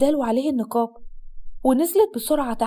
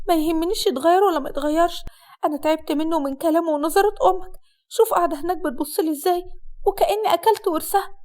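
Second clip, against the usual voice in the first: high-pitched, exasperated bride; fed-up bride; 4 semitones higher than usual. Acted. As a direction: complain as a wife who has had enough. (عروسة زهقانة بتشتكي)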